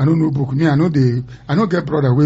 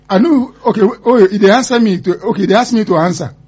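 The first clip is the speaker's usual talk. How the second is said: flustered excitement; 6 semitones higher, 4 dB louder than usual. Real, not acted. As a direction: caught off guard, rushing to agree. Put on the spot on air.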